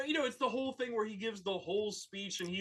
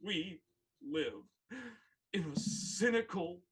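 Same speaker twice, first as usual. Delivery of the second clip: slow dramatic quote; 5 semitones lower than usual. Retold or imitated. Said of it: "he goes".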